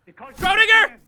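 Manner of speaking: Schrodinger! yelled high and loud; hammy villain cry of Schrodinger!; dramatic shout. Shown as a demonstration. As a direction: shout the name like a mad scientist.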